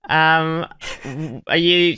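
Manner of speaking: normal talking voice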